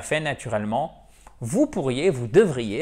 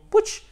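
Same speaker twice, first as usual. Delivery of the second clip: mock dropped ending. Shown as a demonstration. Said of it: "On a tendance à faire ça".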